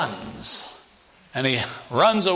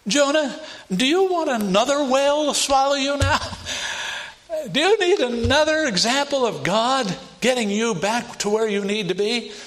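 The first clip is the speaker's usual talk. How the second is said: chiding Jonah; 9 semitones higher than usual. Retold or imitated. Preacher voicing rebuke to Jonah.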